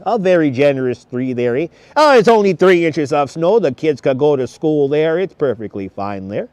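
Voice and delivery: put-on Canadian accent with "eh". Livestreamer dismissing three inches of snow, in a mock Canadian accent. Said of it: "It's a great Canadian accent".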